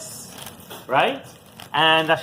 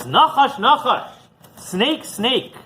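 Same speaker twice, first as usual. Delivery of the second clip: calling out; 6 semitones higher, much slower than usual. Retold or imitated. Rabbi calls out to the snake.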